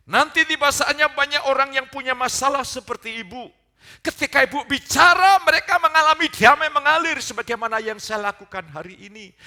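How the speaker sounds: loud, high pulpit proclamation; preaching at full force. Real, not acted. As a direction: proclaim the verse at full volume, high above normal talk. (khotbah berapi-api dengan suara lantang)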